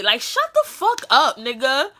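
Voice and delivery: high and loud, fed up; annoyed shut up. Real, not acted. Annoyed retort.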